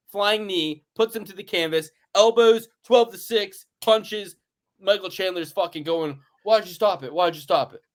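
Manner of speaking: usual speaking voice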